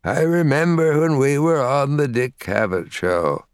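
put-on high, thin, creaky old man voice; feeble old codger voice. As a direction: quote it like a doddering old geezer. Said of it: "in a feeble old codger voice".

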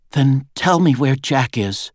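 demanding she be told. A narrator's reading. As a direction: press hard for an answer, each word weighted. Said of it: "she demanded".

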